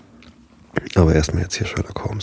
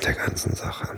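whisper; 11 dB quieter than usual. Real, not acted. To listeners already asleep, whispering. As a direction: drop to a breathy whisper to finish. Not waking sleeping listeners.

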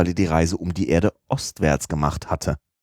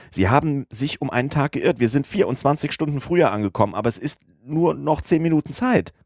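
high, urgent rush of news; frantic high-pitched servant pressing his master to hurry; excited cry; 8 semitones higher than usual. A narrator's reading. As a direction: blurt it out in mounting alarm. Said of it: "rief Passepartout".

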